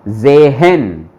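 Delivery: जेहेन stretched slowly, h fully sounded; slow full pronunciation. Shown as a demonstration. Teacher slowly sounds out जेहेन for class. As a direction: draw out जेहेन with a clear h. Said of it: "पूरा बोला जाएगा गेहेन".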